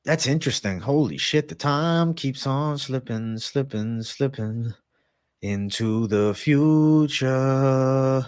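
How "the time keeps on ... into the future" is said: very slow, stretching the words; drawn-out. Acted. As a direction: let the time slip away slowly. Drawn-out musing on time.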